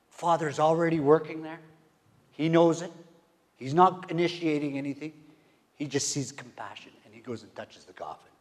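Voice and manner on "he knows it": normal talking voice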